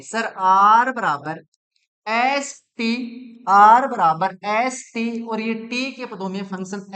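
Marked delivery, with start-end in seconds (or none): 0.0-5.2 slow, louder, word by word; slow emphatic formula. Shown as a demonstration. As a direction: spell the formula out slowly. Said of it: "आप इसको ऐसे नहीं लिख सकते". (धीरे-धीरे ज़ोर देकर फ़ॉर्मूला)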